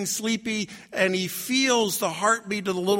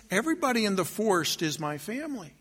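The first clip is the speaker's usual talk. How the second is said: lighter, softer bunny voice; softly musing, in the usual register; wondering bunny; 5 dB quieter, faster than usual. Acted. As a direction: think it aloud with dawning wonder.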